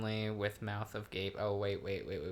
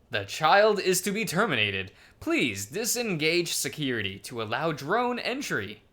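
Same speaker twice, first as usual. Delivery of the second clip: voiced game character; 5 semitones higher than usual. Calm answers Neferu about the door security, in character voice. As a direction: deliver the order in a raised, put-on character voice.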